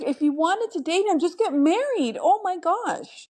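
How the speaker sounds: real exasperation. Real, not acted. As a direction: vent in disbelief, drawing out the final exclamation. Venting about her dating push.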